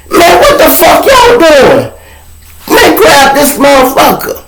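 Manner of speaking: high, loud furious call for help; yelling at police. Retold or imitated. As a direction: shout at the officers to step in.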